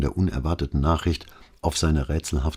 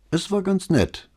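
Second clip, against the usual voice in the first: evasive. Acted. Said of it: "sagte sie schließlich ausweichend".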